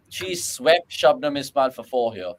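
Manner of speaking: ordinary talk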